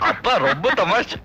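laughing through the words; amused laughing. Acted. Young man humouring his girlfriend's joking father, amused laughing. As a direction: say it through an appreciative chuckle.

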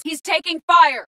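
high-pitched combat alert; alarmed shout. Acted. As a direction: warn that a squadmate is in danger. Squadmate under fire.

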